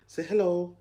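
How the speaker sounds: squeaky high coaxing to his son. Real, not acted. Coaxing baby to greet.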